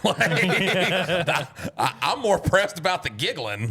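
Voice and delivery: high, loud, laughing protest; cracking up, voice shooting up high; laughing while talking. Real, not acted. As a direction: protest loudly through real laughter. Cracking up over the giggling bit.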